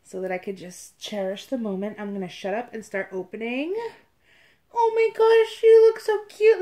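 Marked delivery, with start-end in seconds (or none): 4.7-6.6 high, loud squeal of delight; excited gushing. Real, not acted. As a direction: gush loudly, way up high. Thrilled at first sight of doll.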